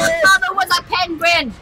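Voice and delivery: high, loud mock taunt; hammed-up battle taunt; yelled silly insult. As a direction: hurl it at the enemy. Yelled insult at game enemy.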